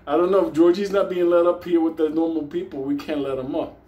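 usual speaking voice

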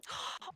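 sharp gasp of surprise; quick, audible intake of breath; delighted gasp. Real, not acted.

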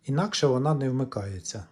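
usual speaking voice

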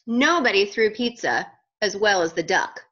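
usual speaking voice

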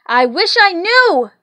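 loud, high, exasperated outburst; harried conductor bursting out; exploding. A narrator's reading. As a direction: blow up in frustration. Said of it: "exploded the conductor".

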